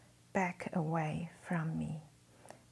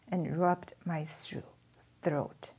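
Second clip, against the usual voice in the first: ordinary delivery, nothing marked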